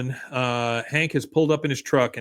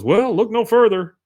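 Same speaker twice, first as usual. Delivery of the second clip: higher, louder mock sales patter; schmoozy salesman; 8 semitones higher, 6 dB louder than usual. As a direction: pitch it like a pushy salesman. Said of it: "the schmoozy salesman".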